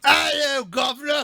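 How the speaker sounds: very high, shouted Cockney greeting; squawky exaggerated Cockney; mock Cockney accent. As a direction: holler like a cartoon Cockney. Mock British greeting in accent game.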